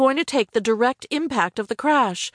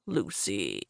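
low, soft coaxing; cajoling; 13 semitones lower, 11 dB quieter than usual. A narrator's reading. Greg trying to talk Lucy round, cajoling. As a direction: coax her softly in a lower voice.

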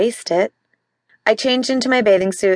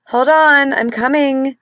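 high, raised call toward the door; 7 semitones higher, slower than usual. Acted. Wife hurrying to answer the banging door, calling out high. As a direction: call across the room so he hears.

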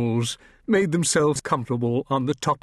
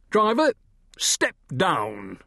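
high, raised command; highwayman; 6 semitones higher, slower than usual. A narrator's reading. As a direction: order the driver down.

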